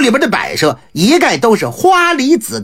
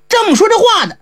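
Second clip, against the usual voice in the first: plain reading voice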